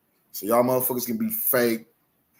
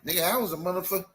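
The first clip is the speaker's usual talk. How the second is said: ordinary talk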